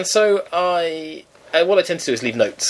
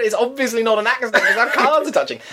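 laughing; 8 semitones higher than usual. Retold or imitated. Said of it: "I couldn't help but laugh".

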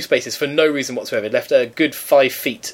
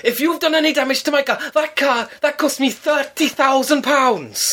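high indignant mock voice of an older man; pompous neighbour; 12 semitones higher than usual. Neighbour lectures her at the door, high and pompous. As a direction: puff up like an officious ex-copper.

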